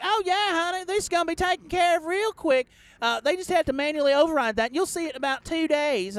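mock the over-friendly agent, high; call center lady. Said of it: "she's like".

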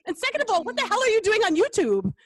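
high, loud comic outrage; mock scolding. Aunt retells scolding young niece over YouTube, high loud outrage. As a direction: demand an explanation, loudly.

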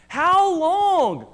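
high earnest call of "How long"; earnest plea. Acted. Lady Wisdom calling to the simple ones, high urgent plea. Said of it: "earnest question".

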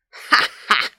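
loud, mocking "ha, ha"; big dinosaur's acted laugh; villainous laugh. A narrator's reading. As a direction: laugh scornfully at a tiny challenger. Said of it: "laughed the Tovosaurus".

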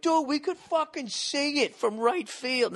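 mock the fans who claim they saw it all along, high and drawn out; mocking know-it-all fan. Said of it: "everybody's like".

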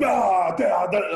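mock outraged coach shouting at the camera; theatrical French coach. Pundit mocking French coaches playing to camera, loud mock outrage. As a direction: ham up a furious French coach. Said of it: "failed actors".

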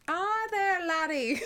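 high, laughing mock Scottish accent. Voicing Nessie for a friend, in a high, hammed-up Scottish accent. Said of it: "it's kinda Scottish".

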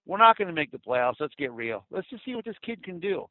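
ordinary talk

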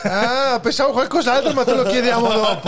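stifled giggles. Real, not acted. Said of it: "Come fai a non ridere?".

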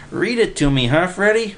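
a wide-eyed eager pal; excited simple guy. A narrator's reading. Narrator voices Willie.

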